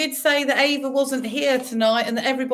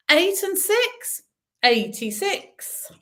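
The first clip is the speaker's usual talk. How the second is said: bingo caller; 9 semitones higher, much slower than usual. Acted. Bingo calling numbers.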